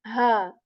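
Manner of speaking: Ha said with extra breath pushed from the throat; airy ha. Shown as a demonstration. Teacher demonstrating the airier ha. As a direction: breathe out hard before Ha. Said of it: "the sound is more airy".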